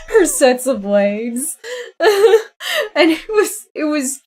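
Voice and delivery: words breaking into high laughter; real giggles through the words; laughing. Real, not acted. Streamer laughing at her cat stretching out, giggling.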